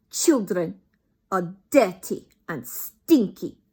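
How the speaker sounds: put-on loud, slow accented scream. Grand High Witch to the crowd, loud accented scream. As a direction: hurl the insult at the children. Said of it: "screamed the Grand High Witch".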